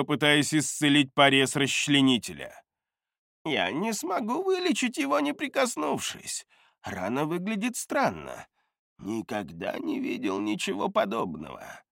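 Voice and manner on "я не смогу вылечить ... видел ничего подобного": put-on high, softer old healer voice; baffled old healer; old man voice. Gerton cannot heal the wound.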